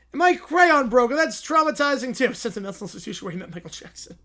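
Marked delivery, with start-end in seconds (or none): 0.1-2.3 high, loud pompous courtroom lawyer; hammy lawyer voice. Acted. To the judge, a blustering lawyer pleading his case. Acted lawyer in mock trial.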